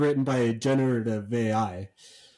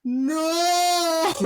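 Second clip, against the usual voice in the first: very high, long drawn-out wail; mock crying kids; 4 dB louder than usual. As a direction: imitate terrified children wailing. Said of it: "four or five kids collectively crying and going".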